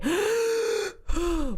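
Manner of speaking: high quick gasp in; sharp chest gasp. Shown as a demonstration. Mom demonstrating wrong chest breathing, sharp gasp. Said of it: "So I'm, like, breathing".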